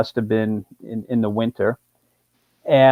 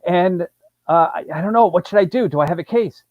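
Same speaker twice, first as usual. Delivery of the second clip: higher, faster retelling of a panicked caller; excited caller; 6 semitones higher, faster than usual. Retold or imitated. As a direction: rattle it off like a client who just got rear-ended. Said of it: "He's all excited".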